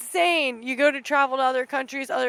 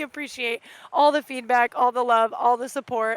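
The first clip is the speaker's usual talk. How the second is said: ordinary talk, nothing marked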